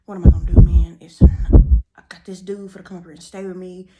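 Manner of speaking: loud panicked outburst; frantic, almost yelling in panic. Retold or imitated. Panicking after slamming door.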